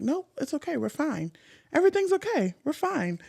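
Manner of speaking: soft, high, reassuring; calm her down gently; soothing reassurance. Retold or imitated. Friend reassuring an upset guest, soft and soothing. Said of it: "she's like".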